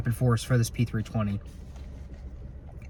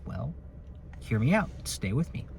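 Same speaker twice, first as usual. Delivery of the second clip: ordinary talk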